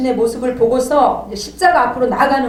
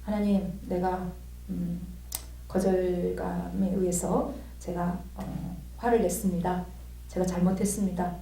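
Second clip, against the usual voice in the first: low, much softer, slow prayer; subdued, halting penitent prayer; humble confession; 4 semitones lower, 10 dB quieter than usual. Retold or imitated. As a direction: confess quietly to God, contrite. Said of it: "주님께 제 고백을 했어요".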